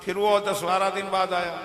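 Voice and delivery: ordinary delivery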